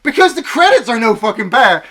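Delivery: loud, high outburst; exasperated shout. Real, not acted. Ranting about the film's credits, exasperated shout.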